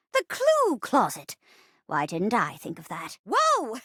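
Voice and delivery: put-on lively, swooping old-lady voice; plucky old granny. Old wife teams with detective for clues, plucky granny voice. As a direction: play an eager old sleuth. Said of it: "I'll be playing the part of the poor old rocking chair".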